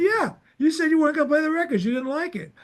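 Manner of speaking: pitched high, fast, indignant recounting; hurled back fast and high with disbelief; retold indignation. Telling Elliot what he said back then, high, fast and indignant. Retelling argument with store owner.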